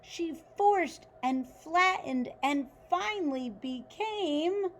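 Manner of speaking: put-on reading a picture book aloud to children; story narration. A narrator's reading. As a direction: tell it like a bedtime story for kids.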